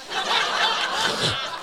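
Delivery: laughter breaking in at the punchline; high laugh. Real, not acted. Laughs at pastor's joke.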